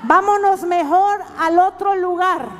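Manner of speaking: loud, high mock grumbling; mocking imitation. Preacher imitating Christians who avoid her shop, loud and mocking. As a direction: imitate a grudging customer, loudly and pointedly.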